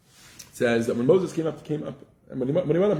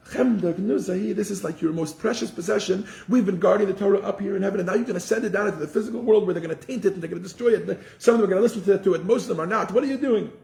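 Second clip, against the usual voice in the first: higher, pleading angel voices; protesting angels; 6 semitones higher than usual. Retold or imitated. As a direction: plead with God to keep the treasure. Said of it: "So they say to God".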